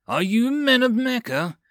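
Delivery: plain reading voice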